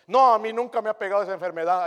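loud, high bragging claim; boastful mock. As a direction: show off being never sick. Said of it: "unos que vienen jactanciosos". (presumiendo en voz alta)

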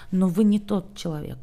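usual speaking voice

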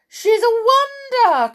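very high, loud rapture; adoring cry. A narrator's reading. Witches about their leader, adoring cry. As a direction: gush over her. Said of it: "cried the audience".

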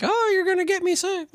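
high mock whiny girlfriend voice; imitating girlfriend. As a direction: tease her complaint in a high put-on voice. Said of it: "She's gonna be like".

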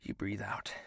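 low soft whisper. Acted. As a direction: whisper it calmly. Breathing exercise.

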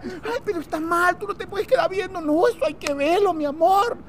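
high, soft scolding and answer; acted beach women. One friend tells the other not to look, acted high voices. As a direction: keep it high and hushed, like gossiping friends.

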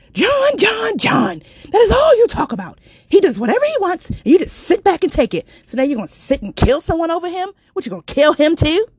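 high, loud angry tirade; yelling at her. Retold or imitated. Friend on the phone yelling at her in a high, loud rant. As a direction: tear into her about John. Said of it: "when someone's yelling at you".

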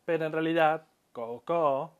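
ordinary talk

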